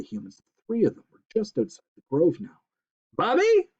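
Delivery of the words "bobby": very high, loud shriek; mother shrieking for her son. Acted. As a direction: call the boy sharply. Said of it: "shrieked Belinda".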